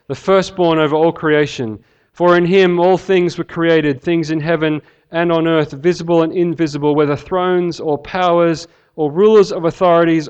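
solemn, building scripture reading. Acted. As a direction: proclaim the verses with rising weight. Reads Colossians aloud.

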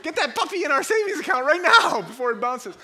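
high, loud comic urgency; hammed-up frantic order; urgent shout. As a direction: hurry him off at full volume.

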